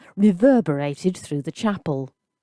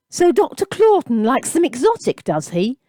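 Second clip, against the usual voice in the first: higher, louder demand; demanding jeer; 7 semitones higher, 4 dB louder than usual. A narrator's reading. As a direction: press him with a sneer. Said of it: "demanded the red-headed man".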